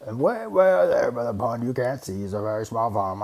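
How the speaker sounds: lightly voiced proud bear; boasting bear. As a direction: show off far-reaching skill.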